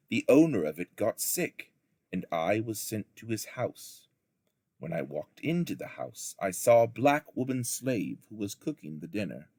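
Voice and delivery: ordinary delivery, nothing marked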